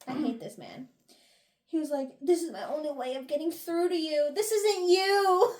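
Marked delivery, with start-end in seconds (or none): 2.2-5.5 mock impassioned plea, rising higher and louder; imitating a desperate appeal, swelling at the end; dramatic retold plea.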